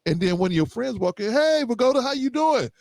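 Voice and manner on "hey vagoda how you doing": lightly voiced high friendly hello; a breezy higher hey to a buddy; friendly greeting. Friend walking into the coffee shop, light high greeting.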